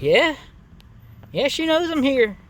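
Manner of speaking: high, breezy fake innocence; mock innocent. To the neighbor asking if she knows, mock innocent.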